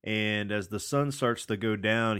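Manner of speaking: normal talking voice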